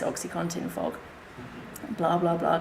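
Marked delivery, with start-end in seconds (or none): none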